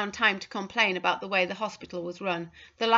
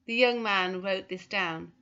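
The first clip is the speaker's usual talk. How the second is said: ordinary delivery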